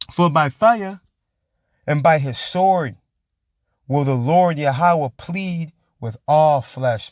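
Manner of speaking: very slow, drawn-out verse reading; preached scripture. Acted. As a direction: stretch each word like a proclamation. Reads scripture aloud.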